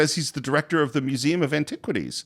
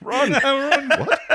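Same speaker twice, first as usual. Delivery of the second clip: much higher, giggling; laughing repeat; 13 semitones higher, slower than usual. Real, not acted. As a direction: burst it out through laughter.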